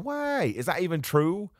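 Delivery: high-pitched, startled double-take; sudden self-doubt. Retold or imitated. Host doubting his own answer about the match, startled. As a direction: jump up high in mock alarm at yourself. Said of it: "but now I've gone".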